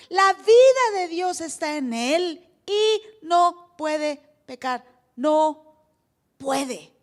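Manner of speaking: loud, slow, punch "No puede"; emphatic preaching. Real, not acted. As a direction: pound each word. Pastor's emphatic conclusion. (prédica enfática y lenta)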